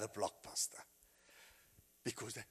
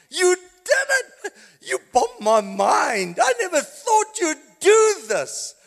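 high, loud, slow joyful shout; exultant shout to the risen Christ; shouting praise; 15 dB louder, slower than usual. Acted. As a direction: cry it out in triumph. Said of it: "in shouting".

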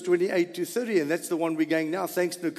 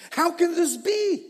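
high, astonished cry; amazed exclaim; 10 semitones higher than usual. A narrator's reading. Crowd amazed at hearing their own language, high astonished cry. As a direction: gasp it out in wonder. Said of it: "they exclaimed".

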